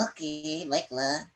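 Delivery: ordinary delivery, nothing marked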